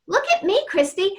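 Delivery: ordinary talk, nothing marked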